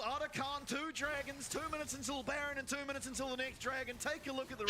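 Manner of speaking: high, excited esports commentary; hyped casting. Acted. Caster hyping the replay to viewers. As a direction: call the action with rising hype.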